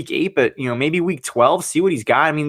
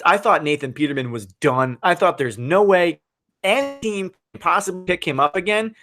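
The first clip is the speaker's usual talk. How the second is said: ordinary talk, nothing marked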